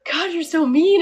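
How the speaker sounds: mock exasperated husband. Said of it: "And he's like".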